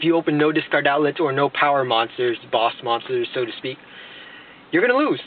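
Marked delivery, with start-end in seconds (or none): 4.7-5.2 sudden high-pitched exclamation; high-pitched verdict. Real, not acted. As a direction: voice leaps way up. Comic verdict on a bad hand.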